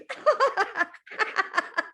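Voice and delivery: high giggle; giggling laugh. Acted. Tickled elephant giggling. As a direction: giggle helplessly.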